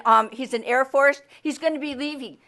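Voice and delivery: usual speaking voice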